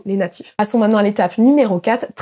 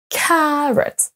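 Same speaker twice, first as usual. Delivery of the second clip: "Carrot" as high, drawn-out CAR, light -rot; first-syllable stress; 5 semitones higher than usual. Shown as a demonstration. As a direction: lean on the start of "Carrot". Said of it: "On accentue sur la première".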